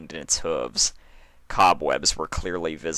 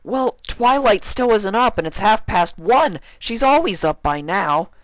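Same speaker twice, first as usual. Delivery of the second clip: higher, louder little dragon voice full of worry; worried Spike; 7 dB louder than usual. A narrator's reading. Spike tells Fluttershy that Twilight is not up, worried. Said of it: "his voice dripping with concern and worry".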